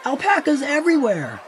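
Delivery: high, loud panicked laughing cry; mock-doomsday laughing shout. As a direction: shriek it through laughter. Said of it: "shouted everyone".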